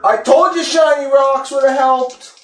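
high, loud exasperated cry; excited shout. Acted. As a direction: blurt it out in alarm. Exclaims as giant grabs rock.

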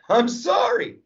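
high, sarcastic fake apology; mock apology. Comic mocking the easily offended with a high fake apology. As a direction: whine a fake sorry, way up high.

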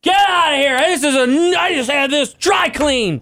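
high-pitched, hammed-up protest; mock outrage. Pie victim with freshly dry-cleaned clothes, mock-outraged. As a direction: squawk it like a pie victim, dragging out the end.

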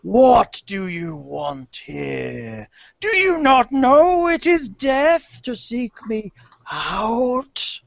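slow, booming spectral voice; menacing banshee, drawn out and high; dark lord. Acted.

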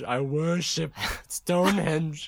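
caveman speak. Pretend. Caveman joke continues.

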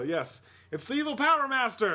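loud, high villain gloat; evil villain voice. Acted. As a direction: boom it out like a villain. Evil villain announces himself.

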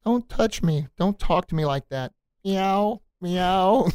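high, timid kitten squeak; little squeaky voice. Shown as a demonstration. Coach showing how not to stand up, squeaky kitty voice. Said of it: "is that a little squeaky voice?".

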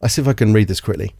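ordinary delivery

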